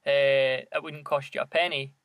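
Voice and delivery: normal talking voice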